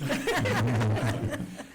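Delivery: loud open laugh; hearty laugh. Real, not acted. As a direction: burst out laughing. Joke about the wrong date.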